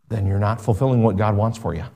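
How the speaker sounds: usual speaking voice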